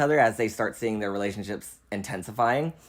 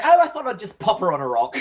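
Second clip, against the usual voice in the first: higher, louder put-on New Zealand accent; 8 semitones higher, 5 dB louder, faster than usual. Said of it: "that's my New Zealand accent".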